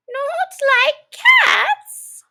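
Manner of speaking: high, slow shrieking outrage; shrill passionate cry. A narrator's reading. The offended mouse shrieks at Alice. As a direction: shriek it in disbelief. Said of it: "in a shrill, passionate voice".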